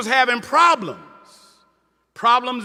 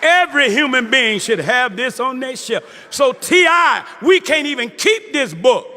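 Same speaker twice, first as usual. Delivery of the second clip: preaching at full force. Acted. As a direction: proclaim it to the whole hall. Preaching about the book.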